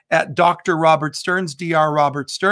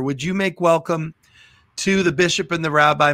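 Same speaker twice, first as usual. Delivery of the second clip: ordinary talk, nothing marked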